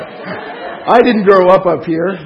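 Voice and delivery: laughing. Real, not acted. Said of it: "coming from somebody with a French accent".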